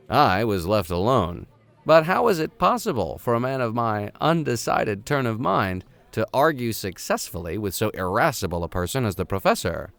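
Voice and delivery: ordinary delivery, nothing marked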